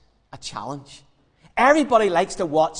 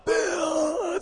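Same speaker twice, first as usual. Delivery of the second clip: high, strained failed shout; voice gone; 11 semitones higher than usual. Acted. Battered prophets still calling Baal, voice gone. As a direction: try to yell with no voice left. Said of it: "They've no voice left".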